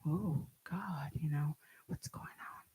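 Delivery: breathless whisper of fear; frightened whisper. Retold or imitated. Reliving her terror at the body bag, whispered. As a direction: whisper it, terrified and unsure. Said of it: "And I'm like".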